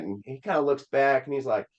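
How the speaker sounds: ordinary talk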